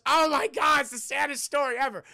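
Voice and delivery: high, loud hammed-up anguish, easing off; mock overwhelmed. Santa moved by Olive's sad story, mock distraught. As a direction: wail it as if overcome.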